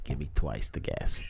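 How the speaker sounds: very low, soft put-on voice; hushed, deep comic demand; mock craving. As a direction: play a deep, greedy request for comedy. Retelling greedy request for more gas.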